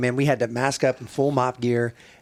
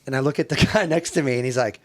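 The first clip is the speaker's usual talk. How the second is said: ordinary talk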